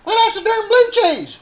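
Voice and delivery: high put-on voice. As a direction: answer in a high squeaky voice. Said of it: "they go".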